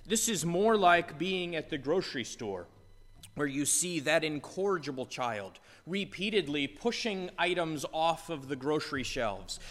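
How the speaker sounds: ordinary talk, nothing marked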